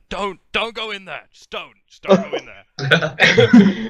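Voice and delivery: loud, slow, laughing cameraman plea; panicky horror cameraman. Retold or imitated. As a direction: beg them not to go in, half laughing. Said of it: "the best would be, like, a horror film where they're filming it".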